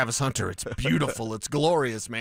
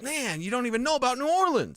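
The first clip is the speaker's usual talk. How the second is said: higher-pitched mock coach complaint; lightly acted higher-pitched grumble; imagined exasperated coach; 6 semitones higher than usual. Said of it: "and then Sean's like".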